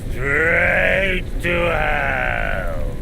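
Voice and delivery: drawn-out thundering doom; booming shout. Acted. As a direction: bellow it, stretched out slowly. Demon's climactic threat.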